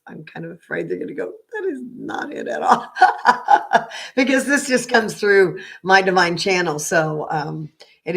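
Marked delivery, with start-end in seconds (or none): 1.5-2.9 a lightly put-on higher voice; playful high-pitched mock retort. Mocking what others might say. 3.0-4.1 short airy laugh; a quick unvoiced chuckle; breathy laugh. Real, not acted. Laughs after her mock quote.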